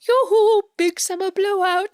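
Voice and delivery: high, singsong shopkeeper greeting; exaggerated high-pitched movie-quote impression; Frozen character. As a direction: chirp it like a cartoon salesman. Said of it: "he's the voice of the one character from Frozen".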